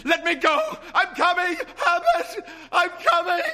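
very high, loud desperate calls; frantic shouting. Acted. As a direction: shout to her son outside. Mother struggling toward the door.

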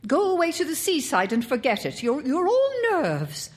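high, hurried outburst; exasperated scolding. A narrator's reading. Mother sends nervy son to the seaside, exasperated. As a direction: order them off in exasperation.

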